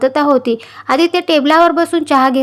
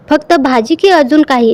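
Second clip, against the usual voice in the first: plain reading voice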